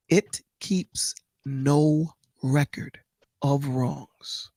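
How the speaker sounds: slow, measured reading of scripture; solemn Bible reading, unhurried. Acted. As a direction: read each line with a pause, like a sermon. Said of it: "I'm gonna read something to you".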